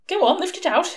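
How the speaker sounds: egging him on, can't wait to see inside; excited. A narrator's reading. Lawyer urges Newt to open the box, excitedly. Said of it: "said Mister Baddicombe excitedly".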